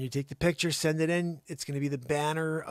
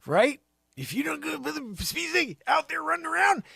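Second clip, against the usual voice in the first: ordinary talk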